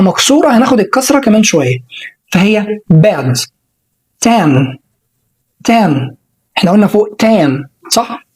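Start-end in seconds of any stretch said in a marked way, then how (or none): 2.3-6.1 bad and tan with a short quick a; short a demo; short quick vowel. Shown as a demonstration. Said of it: "سريعة كده".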